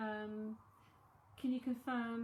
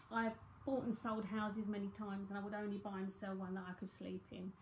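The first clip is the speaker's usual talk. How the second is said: usual speaking voice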